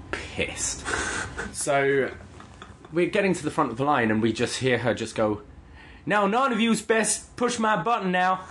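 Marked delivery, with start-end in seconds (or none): 6.1-8.4 high-pitched mock Chicago clerk; imitated Chicago accent. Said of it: "this is how she talked".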